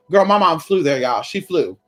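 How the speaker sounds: ordinary talk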